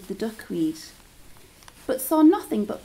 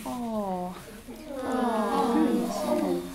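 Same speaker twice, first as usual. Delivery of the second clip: pitying sigh; slower than usual. Shown as a demonstration. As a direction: lead the children in a pitying sigh. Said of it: "We go".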